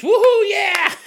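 high, loud whoop of joy; cheering. Pretend. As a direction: whoop it up like a party rat. Cheering as Biggie Cheese.

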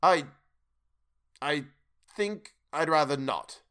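halting stammer with long pauses; falter, reluctant to refuse outright; timid, broken-up reply; nervous hesitation. A narrator's reading. Scrooge hesitates, dreading the spirits.

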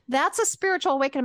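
high, loud and fast insistence; excited friend. Retold or imitated. Friend on a road trip insisting, high and fast. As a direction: imitate the friend telling her, emphatic. Friend reacts to her story.

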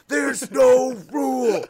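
loud, high, drawn-out shout; outraged sideline yell, very slow; yelling at the ref. Retold or imitated. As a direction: bellow it at the referee, stretching the words. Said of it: "he starts yelling at the head ref".

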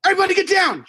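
high-pitched urgent yell; shouted warning. Retold or imitated. Storyteller reliving the drive-by, yelling to everyone in alarm. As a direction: holler it as if under fire. Retold warning as shots ring out.

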